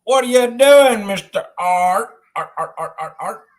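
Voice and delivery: lightly hammed-up announcer; mock radio host. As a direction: hype the name like a show host. Radio-style fishing show bit.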